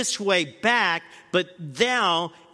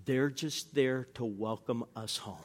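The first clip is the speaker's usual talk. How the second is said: calm pilot; 6 semitones lower, 9 dB quieter than usual. Retold or imitated. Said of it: "He says".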